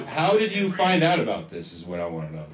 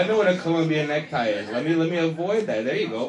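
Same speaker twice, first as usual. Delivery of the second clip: ordinary delivery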